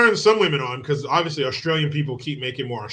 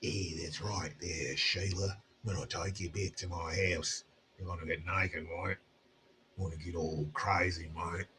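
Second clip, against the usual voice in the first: put-on much lower and much softer Australian accent; mock seductive Aussie; 10 semitones lower, 17 dB quieter than usual. Teasing the ladies with a low, mock-seductive Australian accent. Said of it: "does an Australian accent turn you on".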